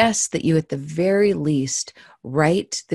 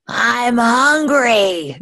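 high, slow, louder drawn-out wolf voice; haunting wolf growl; 9 semitones higher, 7 dB louder, much slower than usual. Acted. The wolf answering the narrator through the door, slow haunting growl. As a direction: stretch it out like a starving wolf. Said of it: "growled the wolf in a voice that would haunt".

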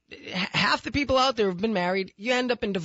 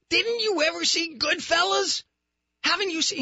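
incredulous scolding; 8 semitones higher than usual. Retold or imitated. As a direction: yell it like he's lost his mind. Host scolding friend Dave.

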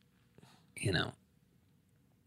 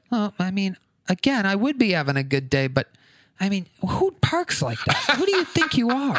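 hint of an indignant old man; 7 semitones higher than usual. Angry old guy voiced in story.

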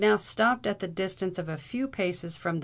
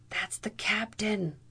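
hushed, slowed, breathy near-whimper; 3 semitones higher, 3 dB quieter than usual. A narrator's reading. Frightened young man to his companion on spotting the captain, whimpering. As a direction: whimper it under your breath, drawn out. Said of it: "almost whimpered the young man".

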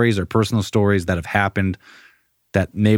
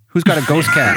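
playful call; 7 semitones higher, 4 dB louder than usual. Real, not acted. Teasing about cat noise.

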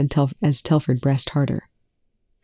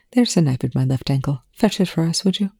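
ordinary delivery, nothing marked

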